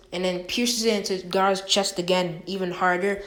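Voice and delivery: usual speaking voice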